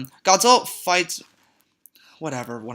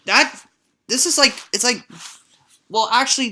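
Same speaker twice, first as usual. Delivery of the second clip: excited; 8 semitones higher, 5 dB louder, faster than usual. Real, not acted. Reacting to horrifying design.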